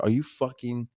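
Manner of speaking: startled. Retold or imitated. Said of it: "like, I literally said".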